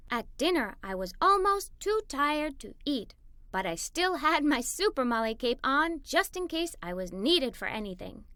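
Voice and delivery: usual speaking voice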